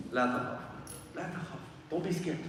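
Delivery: soft, hushed and calming; gentle reassurance. Acted. Angel comforting the dying believer, soft and gentle. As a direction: soothe a frightened man. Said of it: "comes up to you and says".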